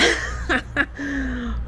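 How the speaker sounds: long breathy laugh. Real, not acted. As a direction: laugh at your own joke. Laughs at his own joke.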